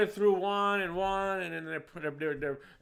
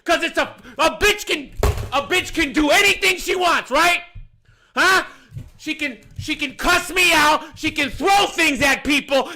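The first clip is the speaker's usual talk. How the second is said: sustained high, loud tirade; furious rant; 9 semitones higher, 10 dB louder than usual. Real, not acted. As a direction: rail at the unfairness at full volume. Furious rant about double standard.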